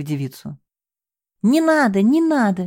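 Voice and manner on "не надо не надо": high, loud panicked begging; frantic pleading. Acted. Девушка вырывается из рук мужчины, панически умоляя. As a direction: struggle free as you plead. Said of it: "билась она в его руках".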